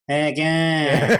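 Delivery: slow, drawn-out Australian "Hey again"; proper Australian accent. Retold or imitated. Co-host teasing the host's greeting, in a slow mock Australian accent. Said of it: "you have to say it with the proper accent".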